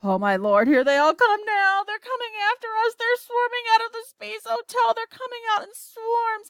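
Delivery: high, rushed alarm as danger swarms in; breathless fright, hurrying away; panicked. Acted. Shuckworth on the radio as creatures swarm him, panicked.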